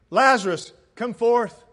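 loud, punchy summons; calling out. Acted. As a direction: call the name out sharply, then the command. Repeats the summons to Lazarus.

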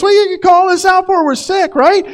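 hammed-up outrage at being called out; mock offended shout. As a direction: tax collector feigning offence. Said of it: "there's offense that could be taken".

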